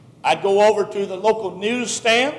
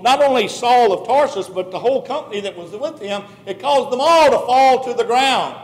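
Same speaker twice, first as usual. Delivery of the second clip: ordinary talk, nothing marked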